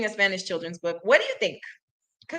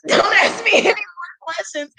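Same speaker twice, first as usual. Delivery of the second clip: laughing defensive shout; 11 semitones higher, 5 dB louder than usual. Acted. Bear refuses more questions.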